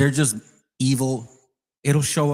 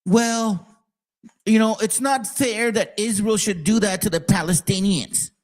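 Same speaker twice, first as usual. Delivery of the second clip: higher, mocking imitation of a smug liberal; 8 semitones higher than usual. Mocking the liberal side he's sick of, in a higher mock voice. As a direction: parody the hand-wringing liberals. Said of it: "that you motherfuckers like".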